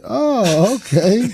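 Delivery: high drawn-out oh of stunned surprise; lightly voiced stunned crowd reaction; awkward surprise. Comedian voicing the crowd hearing her confession, high stunned oh. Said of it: "And we was all like".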